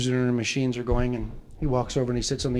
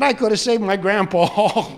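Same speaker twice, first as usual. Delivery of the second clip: slow, louder comic wail; mock crying; 5 semitones higher, 5 dB louder than usual. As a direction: wail the punchline, drawing out the last word. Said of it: "the guy just starts crying more and more".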